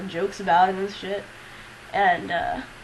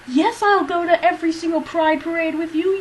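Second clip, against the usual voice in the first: higher, louder, cheerful grandma voice; enthusiastic grandmother; 8 semitones higher, 5 dB louder than usual. Retold or imitated. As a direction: play the grandmother eagerly promising. Voicing her grandmother's reply.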